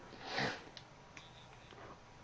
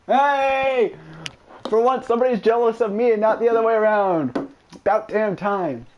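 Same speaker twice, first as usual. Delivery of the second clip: gleeful gloat; 4 semitones higher than usual. Real, not acted. Gloating that someone's jealous.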